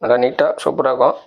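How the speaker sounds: usual speaking voice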